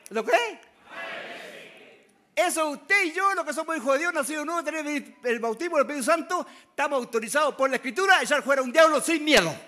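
booming pulpit preaching; preaching at full force. Acted. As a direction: proclaim it to the whole church, loud.

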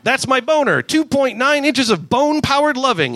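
cocky raccoon bragging to the crew; Rocket voice. Acted.

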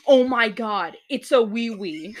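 loud, thrilled exclamation; excited gasp. Retold or imitated. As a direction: blurt it out delighted. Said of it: "I was like".

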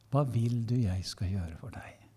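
soft whispered question, close and intimate. Acted. Preacher voicing Jesus's question to the congregation, whispered. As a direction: breathe the question as if into one ear.

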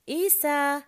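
high, loud call for dad; child calling out. A narrator's reading. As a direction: shout for dad like a little boy. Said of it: "hän huutaa".

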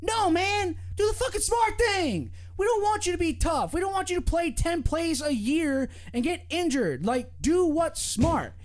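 high, emphatic pleading rant; worked-up and insistent, pitched high; real exasperation. Real, not acted. Exasperated rant answering the fans.

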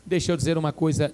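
ordinary talk

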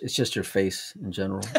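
usual speaking voice

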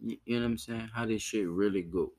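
ordinary talk

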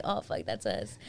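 low amazed aside. Retold or imitated. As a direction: mutter it in disbelief. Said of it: "I'm like".

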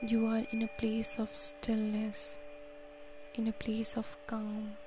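soft, unhurried counting and calming guidance; guided meditation. Acted. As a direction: talk them gently through each breath. Leads a breathing meditation.

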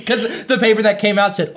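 ordinary delivery

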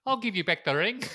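high, loud mock-Kiwi hobbit; little Bilbo. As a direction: put on a high little voice with a bad New Zealand accent. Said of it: "says little Bilbo".

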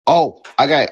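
ordinary talk

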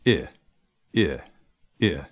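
"I" as the lax vowel in kiss; isolated short "I" sound, as in pitch. Shown as a demonstration. Teacher demonstrating an English phoneme, short i alone. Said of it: "a short I sound".

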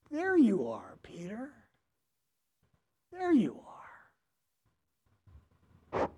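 breathy hushed whisper; tender recognition, barely breathed; soft child's whisper to an old friend. Retold or imitated. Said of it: "small boy tenderly recognizes Peter".

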